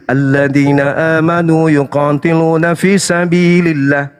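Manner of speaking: slow chanted Arabic recitation; Quran recitation. Acted. Preacher quoting the verse to the opponent, slow chanted recitation. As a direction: intone the verse slowly like a reciter.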